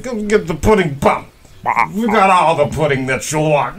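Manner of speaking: lightly put-on pitchman voice; Bill Cosby ad voice. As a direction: sell pudding like an old TV ad. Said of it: "he branded the shit out of it".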